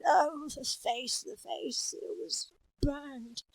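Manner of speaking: slow, whispery lament; anguished burn victim. Acted. Character lamenting a burned face, hushed anguish. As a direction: draw out the horror softly, voice drifting high. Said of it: "someone who had".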